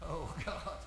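normal talking voice

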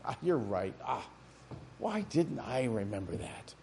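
deadpan God teasing Moses; mock sarcastic God. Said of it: "And God said".

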